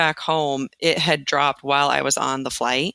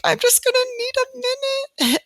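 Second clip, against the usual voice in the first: very high, choked-up plea; teary overwhelm. Retold or imitated. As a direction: near tears, asking for a moment. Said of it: "I started crying".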